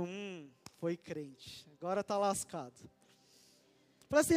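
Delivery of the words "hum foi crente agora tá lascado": low, hushed snide aside under the breath; sarcastic mutter. Retold or imitated. Telling about the Uber driver, a low sarcastic mutter. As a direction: mutter it like a private jab.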